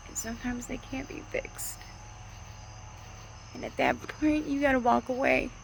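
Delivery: holding back tears while urging people on; getting emotional. Real, not acted. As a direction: voice lifting high and thin with real emotion. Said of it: "Sorry, I'm getting emotional".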